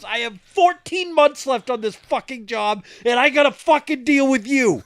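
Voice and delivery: high-pitched shouted rant; trooper losing it. Retold or imitated. Trooper near retirement stuck with this driver, yelling. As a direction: rant at full volume as the exasperated trooper. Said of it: "Fucking loses it".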